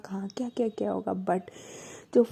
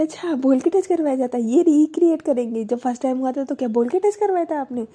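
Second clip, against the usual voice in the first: louder, higher mocking comeback; sarcastic retort; 3 semitones higher, 7 dB louder than usual. Wife mocking her partner's demand, loud sarcastic retort. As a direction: throw her words back at her.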